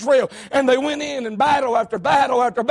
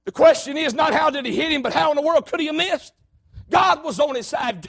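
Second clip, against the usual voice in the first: normal talking voice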